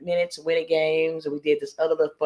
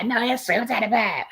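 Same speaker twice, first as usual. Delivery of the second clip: higher-pitched mock correction; imitated quick correction; 6 semitones higher than usual. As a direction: jump in to correct them, imitating her. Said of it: "she was correcting their ass".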